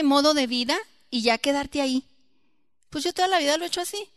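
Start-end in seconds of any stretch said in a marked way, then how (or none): 2.9-4.1 fast, higher stubborn excuse; quick mock of a stubborn believer. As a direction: rattle off a careless excuse.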